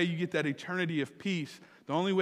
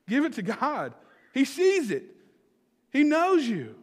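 high, rising preaching voice; impassioned preacher, pitched up and getting louder; fervent exhortation; 9 semitones higher, 5 dB louder, slower than usual. Real, not acted. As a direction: proclaim it in short, ringing phrases.

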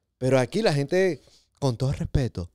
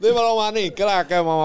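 higher, louder mock Dominican hello; Dominican greeting; 6 semitones higher, 4 dB louder than usual. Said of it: "El saludito dominicano ahí".

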